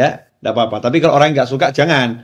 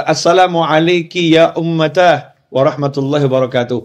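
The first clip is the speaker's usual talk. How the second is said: Arabic greeting; 2 semitones higher than usual. Retold or imitated. Son greets mother in Arabic.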